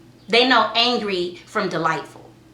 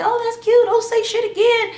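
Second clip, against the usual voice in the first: much higher, louder sing-song scolding; mock indulgent parent; 13 semitones higher, 5 dB louder than usual. Mom mocking lenient parents, high sing-song voice. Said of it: "I didn't say".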